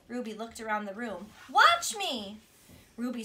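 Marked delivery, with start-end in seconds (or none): 1.5-2.4 eager shout. Acted. Girl calls out to class.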